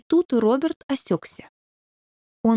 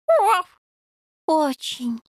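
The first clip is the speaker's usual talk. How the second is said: high start falling to a sigh; 6 semitones higher, slower than usual. A narrator's reading. Puppy sighs to the devil that he really must. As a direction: answer with a heavy sigh. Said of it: "вздохнул Роберт".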